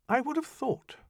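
high, icy reproach; high cutting scorn; cold rebuke. Acted. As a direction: freeze him with disdain.

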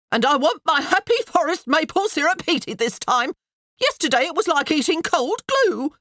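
high, loud scolding; demanding. A narrator's reading. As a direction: demand it from the window. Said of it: "came the demand from the window".

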